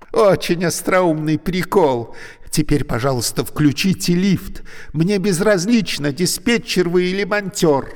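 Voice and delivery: high, loud, irritated and mocking; a woman who thinks she is being pranked; indignant trapped woman. A narrator's reading. Trapped woman scolding the joking voice. Alevtina's voice in story.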